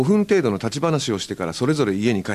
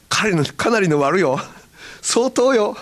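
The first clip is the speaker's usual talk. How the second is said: higher, louder Hakata catchphrase; mock Hakata catchphrase; 9 semitones higher, 4 dB louder, slower than usual. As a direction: repeat the family's favourite line in Hakata dialect. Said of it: "が流行ったのは". (博多弁の決め台詞をまねて)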